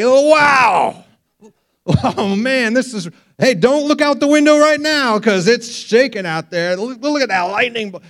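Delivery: high, loud, alarmed rush; mock a pilot freaking out; panicky pilot. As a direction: pilot losing his cool on the intercom, hammed up. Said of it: "you never hear a pilot say".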